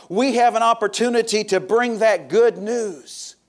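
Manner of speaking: high, driving sermon delivery; preaching. Real, not acted. Preacher telling San Antonio church of the good news, impassioned preaching. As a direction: preach it out with rising conviction.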